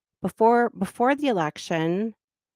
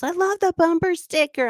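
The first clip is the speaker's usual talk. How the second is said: higher, gushing mock fan; excited; 10 semitones higher, 3 dB louder than usual. Stranger at a stoplight praising her sticker, higher and eager. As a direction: gush like a thrilled stranger. Guy at light enthuses.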